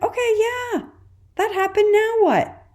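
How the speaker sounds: high, breezy shrug of a voice; shoulder shrug. Pretend. Parenting host shows an accepting mindset, shrugging it off lightly. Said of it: "A sort of shoulder shrug".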